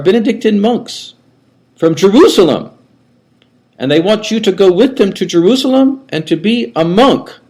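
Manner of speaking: put-on high, thin old man's voice with a German lilt; an elderly Austrian monk recalling his boyhood; old monk's high voice. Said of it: "he had a high voice".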